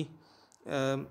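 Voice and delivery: ordinary talk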